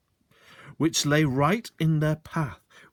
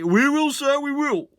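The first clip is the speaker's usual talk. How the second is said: much higher, louder mock obedience; eager answer; 6 dB louder than usual. Sergeant loudly promising the landowner, mock eager. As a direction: play the willing servant. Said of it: "answered Sergeant Samways".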